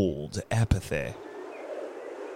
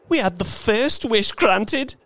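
high, loud feverish insistence; 13 semitones higher, 3 dB louder than usual. Acted. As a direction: press him feverishly. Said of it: "the old woman feverishly".

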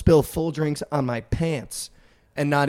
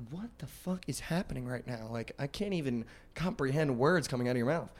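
muttered disbelief; 11 dB quieter than usual. Retold or imitated. Narrator's baffled inner reaction.

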